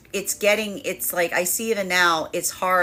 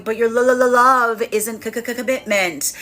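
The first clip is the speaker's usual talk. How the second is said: stammering feminine voice; 3 semitones higher, 4 dB louder than usual. Retold or imitated. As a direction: trip over each word in mock frustration. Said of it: "This is the feminine going".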